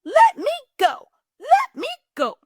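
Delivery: very high, loud piglet squeal; panicked high squealing plea. A narrator's reading. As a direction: squeal to get free. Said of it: "The piglet kicked and squealed".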